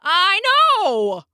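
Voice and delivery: high, loud exasperated burst. A narrator's reading. Engineer to slow dispatcher on phone, exasperated outburst.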